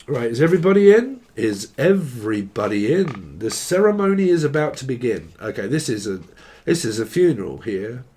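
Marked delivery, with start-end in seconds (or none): 0.1-1.2 loud, fast, high call to the room; rally the crowd, quick and loud; host announcing. Pretend. Master of ceremonies calling the crowd in, loud and fast. 1.3-5.3 solemn host. Pretend.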